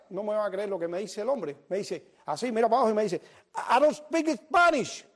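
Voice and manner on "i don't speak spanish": high, drawn-out shout; slow yell down from a rooftop; calling back. Retold or imitated. Man on the rooftop answering a passerby, calling back slowly.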